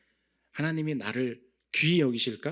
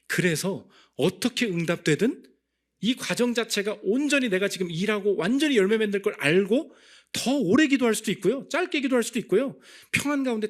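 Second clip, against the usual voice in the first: much higher, louder, building fervor; rising preaching climax; 9 semitones higher, 6 dB louder than usual. Acted. Preacher rallying the congregation, climbing louder and higher.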